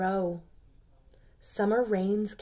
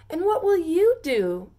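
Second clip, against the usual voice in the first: curious peas; 8 semitones higher, 8 dB louder than usual. Acted. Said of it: "they asked".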